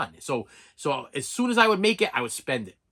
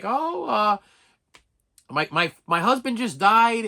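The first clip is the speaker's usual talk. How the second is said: imitated old widow, pitched higher; hesitant old lady, lightly voiced higher; 5 semitones higher, 4 dB louder, slower than usual. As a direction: play a newly widowed old lady haltingly. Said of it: "old lady, and she's like".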